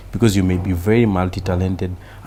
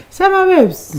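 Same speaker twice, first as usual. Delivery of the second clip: very high, loud mimicry; catchphrase impression; 8 dB louder, slower than usual. Comedian repeating the producer's pet phrase for laughs, high loud impression. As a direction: squeal the catchphrase as a mock character.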